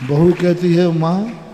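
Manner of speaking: ordinary talk, nothing marked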